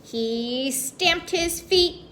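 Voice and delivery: plain reading voice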